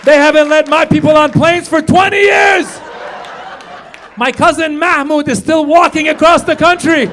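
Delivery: high loud rant; ranting shout. Real, not acted. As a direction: yell it at the crowd. Ranting punchline to crowd.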